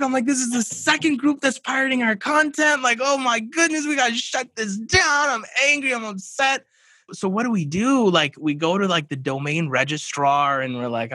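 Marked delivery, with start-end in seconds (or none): 0.3-6.7 high-pitched outraged rant; angry and upset. Retold or imitated. Podcast host retelling his panic over stolen content, high outraged rant. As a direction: vent it like you just found a second thief. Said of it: "I'm going berserk".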